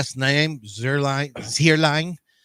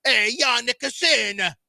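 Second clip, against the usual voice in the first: higher, drawn-out hammed-up Italian voice; Italian accent; 8 semitones higher, 3 dB louder, slower than usual. Shown as a demonstration. Podcast guest shows co-hosts his Italian, exaggerated Italian accent. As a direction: sing out the name in a broad Italian accent. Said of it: "do that in your Italian accent".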